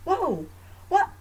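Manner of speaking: high-pitched mock protest; exasperated parent. Mom to daughter who screamed at her, exasperated protest. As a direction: protest in taken-aback disbelief, pitch jumping up.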